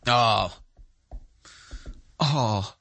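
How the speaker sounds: fake crying. Mocking a journalist's fake tears in an interview, low mock whimper. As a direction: pretend to weep, hammed-up. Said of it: "crocodile fake tears like Taylor Lorenz".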